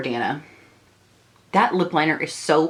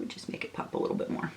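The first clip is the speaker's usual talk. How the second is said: whispered aside; 15 dB quieter, faster than usual. Real, not acted. Murmuring while applying highlighter.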